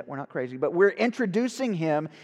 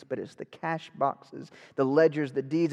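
usual speaking voice

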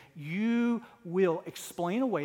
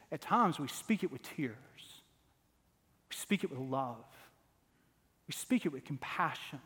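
softer and lower, slowing into tenderness; tender sermon close; 4 semitones lower, 5 dB quieter than usual. Acted. Preacher softening after the climax to urge gentleness, tender. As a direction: pull back and speak it gently.

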